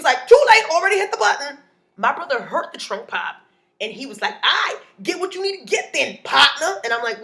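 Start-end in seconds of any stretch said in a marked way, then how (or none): 0.3-1.6 high, loud, fast comeback; imitated rushed yell; shouted retort. Visitor shouts back. 4.4-6.8 loud, high put-on swagger; imitated cocky challenge; challenging brother.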